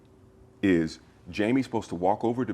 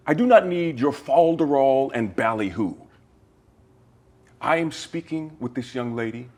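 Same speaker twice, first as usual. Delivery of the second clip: higher, louder, haughty proper diction; prim formal gentleman; 6 semitones higher, 4 dB louder than usual. Acted. Actor scolds Jamie in character, haughty and proper. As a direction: lecture him like an affronted aristocrat. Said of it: "I stayed in character".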